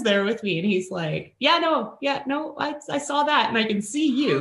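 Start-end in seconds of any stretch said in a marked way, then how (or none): 1.4-4.4 higher, eager reassuring voice; a hint of a flustered husband; imitating husband. Husband reassuring the ignored friend, high and eager.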